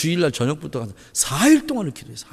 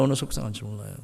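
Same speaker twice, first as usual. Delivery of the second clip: much lower and softer, 얼마나 half swallowed; soft echo; 9 semitones lower, 11 dB quieter, faster than usual. Shown as a demonstration. Pastor echoing his own line to the congregation, soft echo. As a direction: drop to a soft echo of 얼마나 속상한지 몰라요.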